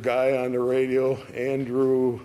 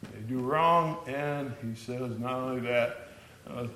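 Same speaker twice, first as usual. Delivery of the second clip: ordinary delivery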